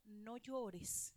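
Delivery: soft, tender "No llores" to a grieving mother; gentle no llores. Shown as a demonstration. Preacher softly voicing Jesus to the widow, tender. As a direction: say "No llores" softly, to calm her. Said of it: "¿Cómo le dijo?".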